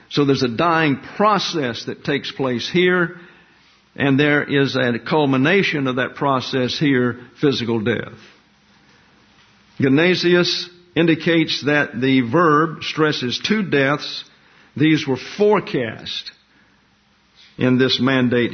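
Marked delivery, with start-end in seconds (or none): none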